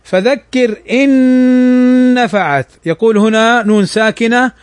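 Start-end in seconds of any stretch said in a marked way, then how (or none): 0.0-2.7 slow chanted tajweed recitation; measured Quranic chant. Shown as a demonstration. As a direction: recite it slowly with tajweed rules.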